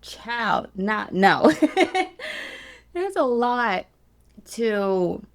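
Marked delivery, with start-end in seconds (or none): 1.6-2.7 very high embarrassed laugh; laughing at getting emotional; high teary laugh. Real, not acted. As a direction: laugh off the tears. Laughing through tears.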